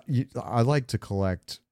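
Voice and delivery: ordinary delivery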